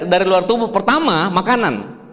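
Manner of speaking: normal talking voice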